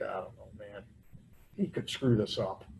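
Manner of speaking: low, hushed reluctance; mock-confide a misgiving under your voice; acted wary doubt. Acts out his doubt about God.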